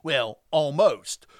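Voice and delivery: normal talking voice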